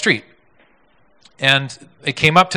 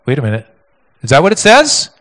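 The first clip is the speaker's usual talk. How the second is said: mock surprise; 8 dB louder than usual. Shown as a demonstration.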